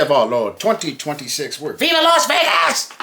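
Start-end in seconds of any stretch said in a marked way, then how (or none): 1.7-2.9 high-pitched Vegas cheer; high exclamation. Pretend. Host hyping the Las Vegas show, high quick cheer. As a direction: call out the city in a high, excited voice. Host shouts out the show's location.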